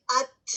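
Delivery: ات ending in a breathy stop; stopped ta demo. Shown as a demonstration. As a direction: close ات on the gum ridge. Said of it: "ہوا جاری رہ رہی ہے".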